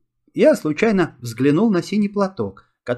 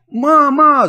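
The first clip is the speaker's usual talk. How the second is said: even higher, much louder desperate wail; louder scream for mum; 11 semitones higher, 9 dB louder than usual. A narrator's reading. Boy screaming again for his mother, desperate wail. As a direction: scream harder as no one comes. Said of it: "завопил я".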